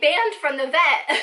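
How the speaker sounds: loud, laughing through the words; real laughter telling of the ban. Real, not acted. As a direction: laugh it out in disbelief.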